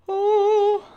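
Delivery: sudden loud high cry of shock; a startled yelp at the song choice; real startled yelp; surprised. Real, not acted. Reacting to the song pick.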